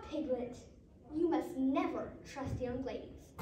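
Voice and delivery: ordinary delivery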